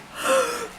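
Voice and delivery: a high delighted intake of breath; surprised gasp at the door; sharp excited gasp. Retold or imitated. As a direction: gasp as the parcel arrives. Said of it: "and I was like".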